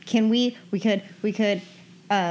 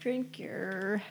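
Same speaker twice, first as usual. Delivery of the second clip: usual speaking voice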